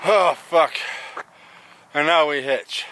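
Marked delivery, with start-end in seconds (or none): 0.1-0.7 sharp high burst on Oh, fuck; jolted out of the walk; startled curse. Real, not acted. Hiker arriving at the road, startled high curse. Sudden reaction on reaching the road.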